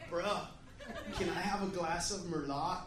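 low, laid-back dude voice, lightly done; lightly voiced beach dude, "Merlot" as mer-LAH; California beach dude. Friend ordering wine from waiter, mild California dude. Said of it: "like, a total beach California dude".

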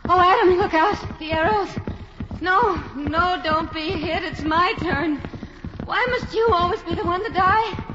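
high, rising, frantic cries; delirious terror. Acted. Delirious woman cries out to Adam, high and frantic. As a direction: scream his name from a nightmare.

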